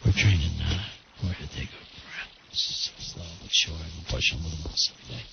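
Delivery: whispering. Real, not acted. Whispered aside on air.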